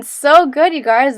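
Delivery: bright, loud cheer of praise; excited praise. Real, not acted. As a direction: cheer them on, loud and high. Praising class after the set.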